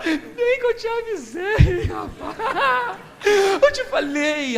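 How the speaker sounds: high, slow mocking laugh through the words; sing-song I-told-you-so, laughing; gloating told-you-so. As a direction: rub it in with a laughing sneer. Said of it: "ele fala".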